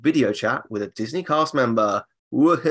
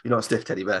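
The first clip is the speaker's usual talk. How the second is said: normal talking voice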